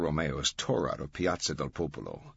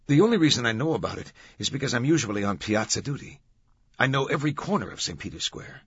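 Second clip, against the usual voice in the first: higher, quick guard's voice; guard explaining himself; 5 semitones higher, 3 dB louder, faster than usual. Acted. Guard explains how he knows the square, explanatory. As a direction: explain eagerly in a raised pitch.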